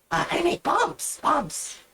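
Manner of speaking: high, gleeful goblin. Acted. Goblin girl proudly presenting her bombs, high and gleeful. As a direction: show off the bombs with glee.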